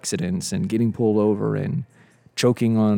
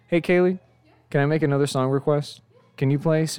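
calling out; 6 semitones higher than usual. Real, not acted. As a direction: call out to get her attention. Calling to friend across room.

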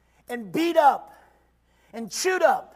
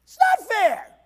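God's blunt answer; 5 semitones higher, 4 dB louder, slower than usual. Retold or imitated. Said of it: "He's gonna tell you".

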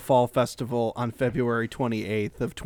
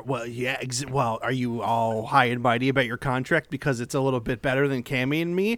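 ordinary talk, nothing marked

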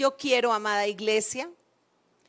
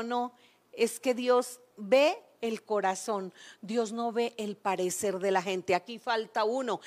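hushed prophet voice; 3 semitones lower, 7 dB quieter than usual. Acted. Prophet voiced in Bible story.

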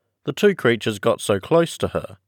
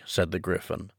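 ordinary delivery, nothing marked

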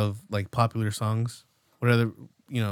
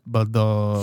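normal talking voice

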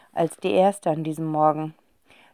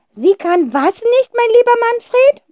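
put-on old aunt; 7 dB louder than usual. A narrator's reading. Aunt answering the phone, amused. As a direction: stretch it out like a cheeky old lady. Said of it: "fragte Tante Rotzinder gut gelaunt".

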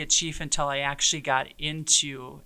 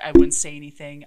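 ordinary talk

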